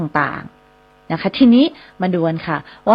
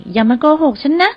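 mock scolding; 7 semitones higher than usual. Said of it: "Don't lie to me".

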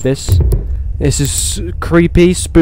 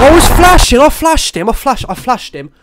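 much higher, louder indignant protest; outraged yell; 9 semitones higher, 4 dB louder than usual. Real, not acted. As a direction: protest at the game's unfairness. Dies despite flashing monster.

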